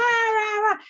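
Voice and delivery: high-pitched nonsense yelling; mock shouting. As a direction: imitate a ranting shouter with gibberish. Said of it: "if I start to shout".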